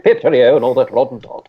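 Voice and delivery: hammed-up toff English; a silly fake posh Brit; cartoon English gent voice; mock British accent. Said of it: "speaking in ... an English accent".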